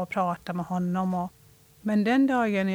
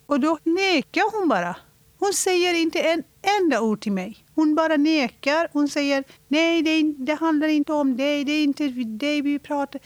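agitated retelling; 6 semitones higher than usual. Retold or imitated. Retelling mother's denial.

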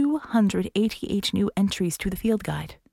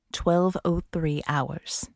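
ordinary delivery

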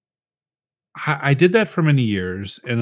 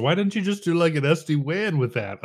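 mock clueless kid; 5 semitones higher than usual. Mocking young coworker's question.

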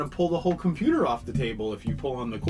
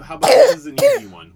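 excited; 14 dB louder than usual. Real, not acted. Parent chirps to coax child.

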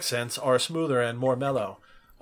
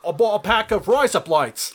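higher, louder mock Aussie; Australian accent; 6 semitones higher, 6 dB louder than usual. Co-host joining the razor blades joke, loud Australian accent. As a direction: belt it out in a put-on Australian twang. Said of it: "You just said razor blades in Australia".

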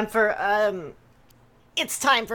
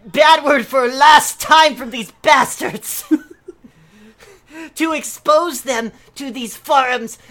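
mock outrage; 5 semitones higher, 6 dB louder, slower than usual. Ranting forum post in broken English.

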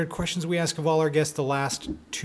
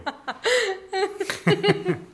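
a high, real burst of laughter. Real, not acted. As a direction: let the laugh fly up high. Laughing at own joke.

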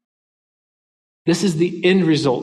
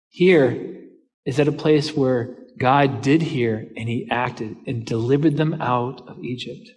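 normal talking voice

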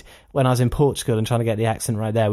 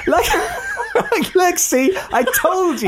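laughing; much slower than usual. Real, not acted.

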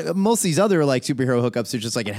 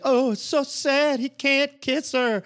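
mock Gambit Cajun accent; 6 semitones higher than usual. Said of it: "I am so tired of that fake".

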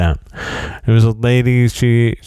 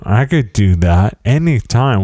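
ordinary talk

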